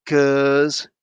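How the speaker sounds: ordinary talk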